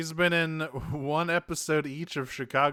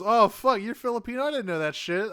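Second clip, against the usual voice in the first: high, loud burst of mock surprise; surprised; 9 semitones higher, 5 dB louder, faster than usual. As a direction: blurt it out high, settling as you go. Voicing Bumblebee's surprised reaction.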